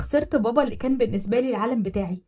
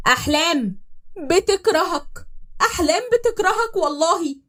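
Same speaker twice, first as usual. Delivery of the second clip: غضب مكتوم; 8 semitones higher, 4 dB louder, slower than usual. Acted. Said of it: "اتكلم وهو بيضغط على اسنانه".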